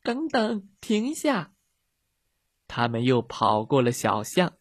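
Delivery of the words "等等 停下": high-pitched calling after someone. Acted. Girls running after the dog, calling out. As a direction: call out high, without much volume.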